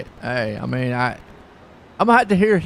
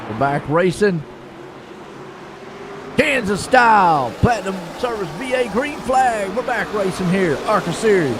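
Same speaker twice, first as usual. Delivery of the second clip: green flag restart called out with a lift; play-by-play call; 2 semitones higher than usual. Acted. Commentator calling the green flag restart for viewers.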